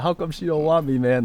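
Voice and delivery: a touch of mock sobbing boyfriend; whiny heartbroken plea. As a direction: imitate a crying guy whining. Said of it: "He's like".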